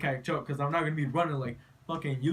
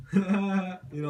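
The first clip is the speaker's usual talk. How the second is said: laughing; 3 semitones higher than usual. Retold or imitated. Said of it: "I'm just laughing and shit".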